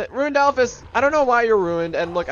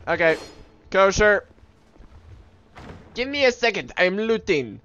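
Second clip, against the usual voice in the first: normal talking voice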